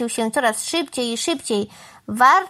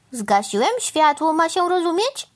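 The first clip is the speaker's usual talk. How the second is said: higher-pitched, self-assured exclamation; calling out; 7 semitones higher than usual. A narrator's reading. Crow explains his trick to the cow, calling out. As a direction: call it out as if obvious. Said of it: "Zawołał pan Wrona".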